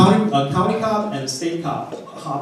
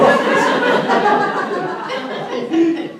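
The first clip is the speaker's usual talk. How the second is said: laugh; 4 dB louder than usual. Real, not acted. Laughing at friend's release.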